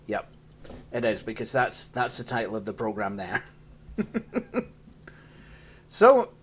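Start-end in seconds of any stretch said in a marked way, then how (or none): 3.9-4.6 short high giggle; laughing. Real, not acted. User amused by the program's title, giggling. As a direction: giggle briefly to yourself.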